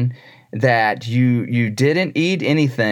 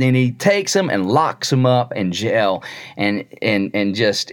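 ordinary talk, nothing marked

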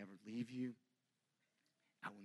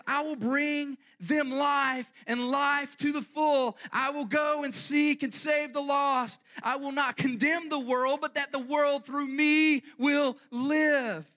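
slow, much louder and higher proclamation; declaring shout; 17 dB louder, slower than usual. Acted. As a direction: shout the mission out like a vow. Jesus declaring mission to Father.

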